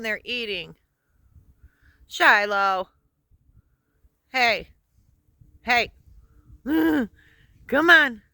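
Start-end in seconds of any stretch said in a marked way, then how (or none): 2.1-8.2 loud, high call to the dog. Real, not acted.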